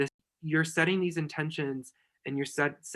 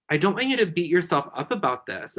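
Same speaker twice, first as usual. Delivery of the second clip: ordinary talk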